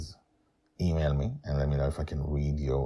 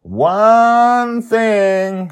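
high, loud, drawn-out and cheerful; happy; 13 dB louder than usual. Acted. Joy cheerfully refusing to leave the dream, high and loud. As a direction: sing it out playfully. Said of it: "she says happily".

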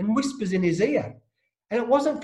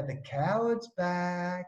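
slow, drawn-out sneering taunt; mocking taunt; 7 dB quieter, much slower than usual. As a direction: drag it out to mock him. Stalker's taunt voiced.

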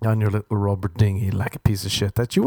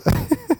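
high laugh. Real, not acted. Laughs at own story.

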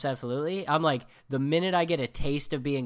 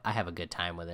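ordinary talk, nothing marked

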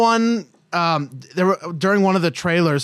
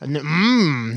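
ordinary talk

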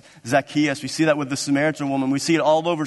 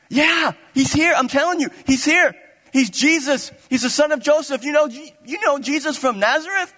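very high, emphatic, breathless-with-news delivery; excited insistence; 12 semitones higher, 4 dB louder than usual. Acted. Philip assures Nathanael that he's here, excited. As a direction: insist eagerly at the top of the voice.

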